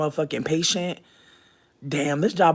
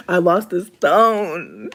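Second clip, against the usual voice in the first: mock wail; 3 semitones higher, 8 dB louder, much slower than usual. Dramatic lament over lost stone.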